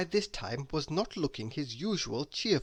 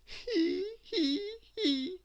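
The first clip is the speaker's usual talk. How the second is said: very high, tearful he he; a laugh that nearly cries; miserable laugh; much slower than usual. A narrator's reading. Miss Giggles tries again, miserable and tearful. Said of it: "she said miserably".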